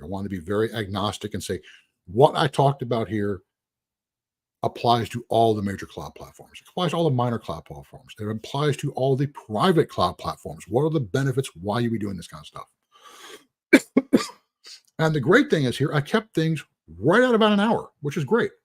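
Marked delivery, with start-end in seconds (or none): none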